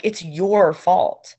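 slow, drawn-out accusation, stretching "your"; hammed-up scolding of a partner, slow and emphatic; mock blaming. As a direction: playfully lay the blame, dragging each word. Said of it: "because I'm like".

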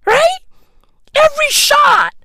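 gleeful high disbelief about the weight gain; high excited squeal. Real, not acted.

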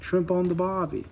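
a hint of an Australian ad voice; mock Australian accent. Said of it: "you're just thinking".

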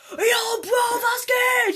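high, drawn-out loud shout. Pretend. Boy imagining only being able to shout in class, shouting. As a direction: yell it out at full voice. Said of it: "stell dir vor, du schreist so".

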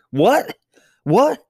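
high, loud double what of a stunned big brother; incredulous what. Retold or imitated. Big brother stunned by his little brother. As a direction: squeak it out in disbelief.

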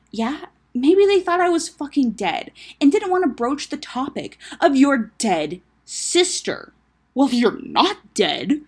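loud, slow, high indignation; heated argument. Acted. Siblings arguing over her supposed death, heated. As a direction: throw it back bitingly, drawn out.

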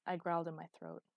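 ordinary delivery

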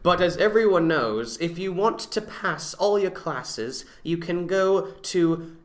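slow, grand pitch-style reading; mock advert read. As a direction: sell it like a cheesy ad. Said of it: "let's read this out".

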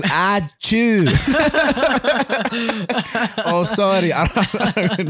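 high, loud achoo in a mock Latin accent; Sofia Vergara. Joking that the actress sneezes accented, mock Colombian accent. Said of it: "sneezes with an accent".

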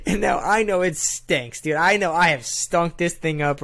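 ordinary talk, nothing marked

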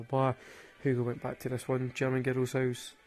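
normal talking voice

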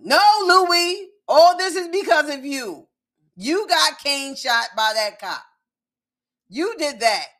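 loud, high, emphatic scolding; heated telling-off. Pretend. Fan blaming Louis for Kane being shot, heated scolding. Scolding a show character directly.

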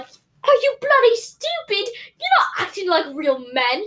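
high, hammed-up British scolding; outraged Brit, British accent stretched out high; mock British accent. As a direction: put on an indignant British accent. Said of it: "the British were like".

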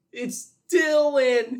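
high, loud drawn-out cry; dramatic reveal, yelled long and high; shouted reveal. Acted. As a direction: draw the name out in a big high shout. Acted reveal of the name.

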